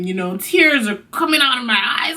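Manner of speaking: ordinary talk, nothing marked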